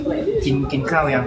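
slow, low-pitched question; casual question in a deep, unhurried register; low natural voice. Shown as a demonstration. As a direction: drop low and take it slowly. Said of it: "เผลอพูดเสียงแบบปกติตอนเสียงต่ำ".

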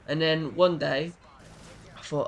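ordinary delivery

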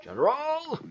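high, sing-out call; summoning call. Acted. King summons the general over an outbreak, high call. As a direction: call out for an officer, pitched right up.

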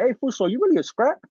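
high, teasing jab at a nephew; lightly imitating his uncle's playful ribbing; joking uncle. Uncle teasing nephew about his gang, high joking tone. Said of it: "he jokingly".

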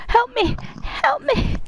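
high, panicked yelling; screaming for help. Real, not acted. Calling for help while chased.

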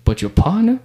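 mock incredulous. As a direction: scoff at the partner like the pimp. Pimp's remark, voiced.

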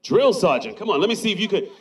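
normal talking voice

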